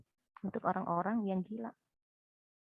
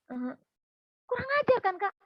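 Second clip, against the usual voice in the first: much higher, louder indignant cry; retold friend fuming at the rude doctor; mock outrage, high and loud; outraged; 13 semitones higher, 8 dB louder than usual.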